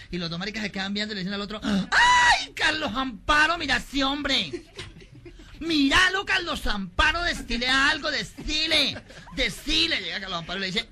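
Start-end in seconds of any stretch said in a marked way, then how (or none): 1.6-10.0 high, loud, drawn-out excitement; campy swooning. Acted. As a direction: squeal to a friend in hammed-up delight. Amigo embelesado exclama ante el grandote. (emoción chillona y afectada)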